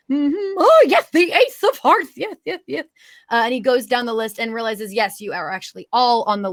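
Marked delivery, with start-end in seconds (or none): 0.0-2.8 high, dotty humming and muttering; Mad Hatter voice. Acted. Mad Hatter checking his guest list, high and scatterbrained. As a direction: pore over a list in a high character voice. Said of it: "he goes and grabs a clipboard".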